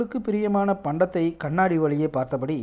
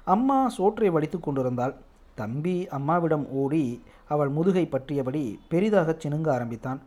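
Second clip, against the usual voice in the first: usual speaking voice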